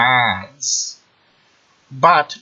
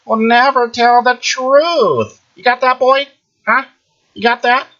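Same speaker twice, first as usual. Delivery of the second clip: taunting high voice; 10 semitones higher, 4 dB louder than usual. Acted. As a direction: needle him from way up high. Taunting the listener.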